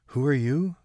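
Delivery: put-on a startled girl asking who's there; Kate asking. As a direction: call down into the dark, uneasy. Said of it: "Kate asked".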